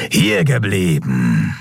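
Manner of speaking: slow, menacing stop order; rumbling command. A narrator's reading. Cult leader ordering the fugitives to stay, slow. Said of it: "brummte ihr Anführer".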